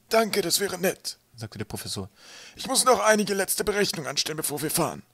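high-pitched, put-on scholar's voice; professor character. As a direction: play a polite professor in a high voice.

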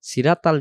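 "ইহদিনাস সিরাতাল" spoken quickly as hindenas seratal; mistaken light letters. Shown as a demonstration. Teacher showing the common mistake, "সিরাতাল" as flat seratal. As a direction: use thin a and light t in "সিরাতাল". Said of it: "অক্ষরটা তখন ভুল হয়".

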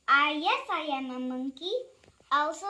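plain reading voice

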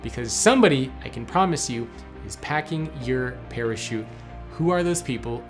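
ordinary delivery

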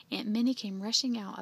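plain reading voice

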